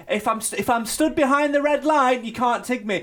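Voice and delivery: a hint of childish protest; lightly voiced schoolchild. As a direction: insist on the rules like a kid playing tag. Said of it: "when you played tig at school and you say".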